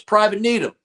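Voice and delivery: sharp, high, loud sergeant call; drill sergeant voice. Retold or imitated. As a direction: bark it like a drill instructor. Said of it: "he goes".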